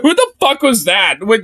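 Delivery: usual speaking voice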